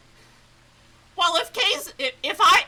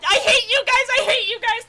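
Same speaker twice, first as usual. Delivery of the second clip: high, loud mock outrage; playful yell; 8 semitones higher, 7 dB louder, faster than usual. Real, not acted. Gamer teasing the characters, playful loud yell.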